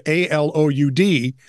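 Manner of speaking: A L O U D spelled letter by letter. Shown as a demonstration. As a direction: spell out A L O U D slowly.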